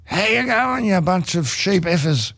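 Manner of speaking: high, slow, drawn-out taunt at the crowd; drunk rock singer. Retold or imitated. [name] greets the New Zealand crowd, drunken high slow taunt. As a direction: ham up a plastered rocker greeting the fans. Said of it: "grabs the mic and goes".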